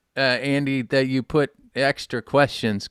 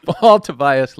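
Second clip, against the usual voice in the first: announcer intro; 7 dB louder, slower than usual. Acted. Host hypes guest introduction.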